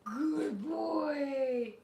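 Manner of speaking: loud, tearful-sounding praise; gushing praise. Real, not acted. Praising her dog after the treat, gushing loudly. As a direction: beam it at the dog.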